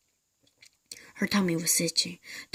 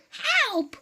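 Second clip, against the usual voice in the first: much higher shriek for help; scream. Acted. Mrs Twit shrieking at the frog. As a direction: shriek in terror. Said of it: "screamed Missus Twit".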